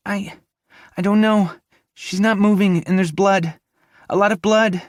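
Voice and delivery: put-on high, scared girl's voice under the rubble; a panicked young woman calling out from under the debris; Avery trapped, frightened. Avery calls out to Grayson from the rubble, high and frightened.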